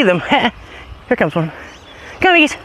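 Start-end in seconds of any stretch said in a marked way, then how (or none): 2.2-2.6 high call to dog. Real, not acted. Calling dog.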